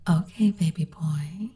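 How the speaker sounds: ordinary talk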